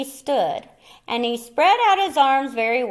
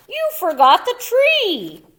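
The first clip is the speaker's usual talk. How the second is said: put-on child voice; 9 semitones higher, 5 dB louder than usual. Child accusing Pop.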